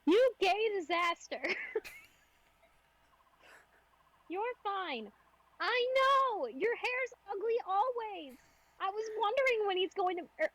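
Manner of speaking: put-on high, teasing girl voice; teasing character voice. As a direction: rib him affectionately. S0 plays character, higher.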